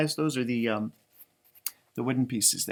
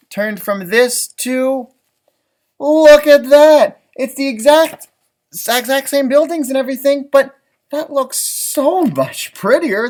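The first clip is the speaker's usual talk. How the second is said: much higher and louder, slow and drawn out; thrilled reveal; 8 semitones higher, 9 dB louder than usual. Real, not acted. Narrator unveils the garden city to young listeners, thrilled and loud. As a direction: unveil it like a magic trick, slowly and loudly.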